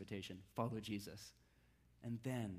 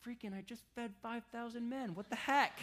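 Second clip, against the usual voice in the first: a hammed-up, higher exasperated rant; mock exasperated Jesus; 6 semitones higher than usual. Exasperated, half-joking, higher pitch. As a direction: pastor plays an annoyed Jesus fed up with disciples, mock exasperation. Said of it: "I would".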